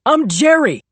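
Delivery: high loud shouted name; shouting. A narrator's reading. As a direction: shout it across while running. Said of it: "the campus cop shouted to me".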